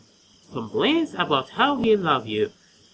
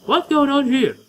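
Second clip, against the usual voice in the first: put-on higher and louder girly take; 9 semitones higher, 6 dB louder than usual. Shown as a demonstration. Streamer voicing the girl character, higher girly voice. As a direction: pitch it up into a tomboyish girl. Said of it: "a girly voice, but a still boyly voice".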